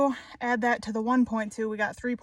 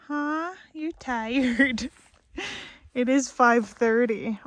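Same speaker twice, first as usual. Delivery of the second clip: usual speaking voice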